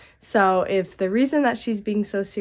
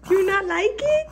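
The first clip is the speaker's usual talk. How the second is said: high-pitched cooing question; 11 semitones higher than usual. Real, not acted. Mother checks whether baby likes apple, high baby talk.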